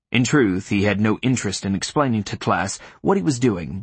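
ordinary delivery, nothing marked

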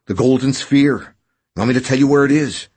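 loud, insistent bargaining; stubborn. A narrator's reading. Injured man bargaining with his rescuer for escape, stubborn. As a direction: press the demand forcefully, digging in. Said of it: "Burbage said stubbornly".

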